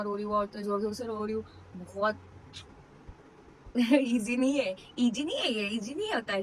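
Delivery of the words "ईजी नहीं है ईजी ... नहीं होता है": laughing admission. Real, not acted. New mother tells viewers labour is not easy, laughing. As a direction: admit it through a laugh, stressing नहीं.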